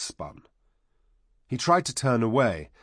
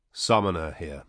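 slightly lowered summoner's voice; a hint of the summoner's deep command; deep-voiced summoner; 3 semitones lower than usual. A narrator's reading. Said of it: "in his deep voice".

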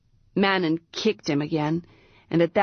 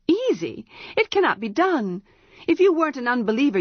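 scornful; 6 semitones higher, faster than usual. A narrator's reading. Priestess scornful outburst.

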